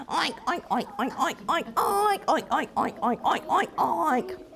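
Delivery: soft sung oinks like a happy pig; piggy oink tune; singing pig oinks. Acted. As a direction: oink out a little pig song. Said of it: "he started singing too".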